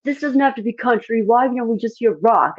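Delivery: high mock drunk guy voice; lightly voiced rowdy heckler; imitating a drunk fan. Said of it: "And he's like".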